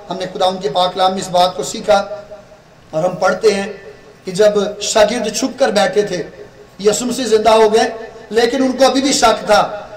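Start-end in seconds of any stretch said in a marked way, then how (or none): none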